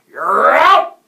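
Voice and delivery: explosive, high, loud call; umpire's shout. A narrator's reading. The umpire calls the batter out, shouting. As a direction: bellow the ruling. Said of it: "the umpire shouted".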